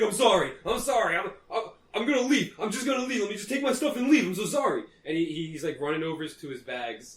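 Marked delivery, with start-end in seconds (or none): 0.0-4.8 high, rushed begging apology; frantic pleading. Acted. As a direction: grovel in panic.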